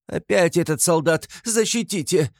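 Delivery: high-pitched alarmed appeal; crying out. A narrator's reading. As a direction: cry out to an official for protection. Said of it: "закричала она чиновнику". (испуганно кричит высоким голосом)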